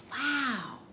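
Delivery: plain reading voice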